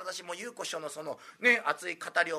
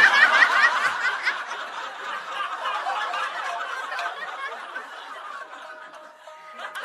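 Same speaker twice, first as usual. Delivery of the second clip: big laugh; 7 dB louder than usual. Real, not acted. Audience-facing big laugh.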